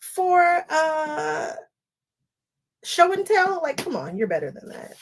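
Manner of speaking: high, teasing scold of herself; a hint of a playful chiding friend; mock scolding. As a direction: tease yourself like a disappointed friend. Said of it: "I was like".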